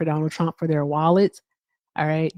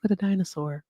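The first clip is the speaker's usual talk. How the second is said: ordinary talk, nothing marked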